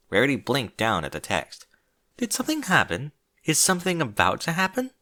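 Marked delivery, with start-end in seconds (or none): 2.2-4.9 nervous. A narrator's reading. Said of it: "she asked nervously".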